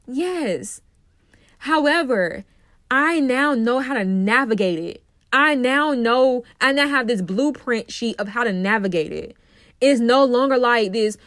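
ordinary delivery

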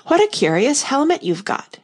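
brightly steer away from the topic; Alice cheerfully changing the subject to the helmet. A narrator's reading. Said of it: "she said cheerfully".

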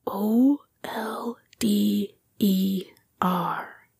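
slow whispered spelling, letter by letter; hushed, drawn-out spelling. A narrator's reading. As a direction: whisper each letter with a gap after it. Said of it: "Jim whispered".

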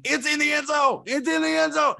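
high-pitched screamed touchdown call; screaming sportscaster. Retold or imitated. Imitating a home radio broadcast calling the big play, screaming. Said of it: "where they're just screaming".

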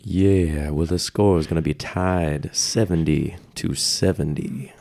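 low, slow sultry voice, lightly done; a hint of sultry narrator. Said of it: "most, uh, sultry, uh, voice that you can".

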